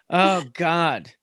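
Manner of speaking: ordinary talk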